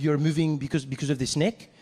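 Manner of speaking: put-on incredulous French-accented question; French-accented tourist, baffled. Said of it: "the French guy turns to me".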